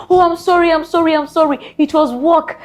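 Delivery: high, pleading mock apology; high hammed-up sorry. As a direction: imitate the partner begging sorry. Mocking partner's apology.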